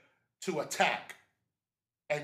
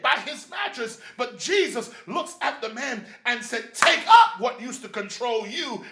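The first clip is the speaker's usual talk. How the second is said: raised, driving pulpit delivery; full-force preaching; 4 semitones higher, 5 dB louder than usual. Acted. Preacher retelling Jesus healing the man on his bed, full-force preaching. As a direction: build it up and punch the key phrases.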